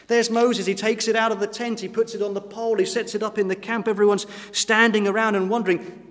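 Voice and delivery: ordinary talk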